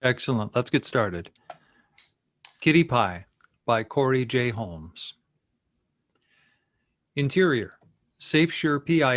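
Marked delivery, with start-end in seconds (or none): none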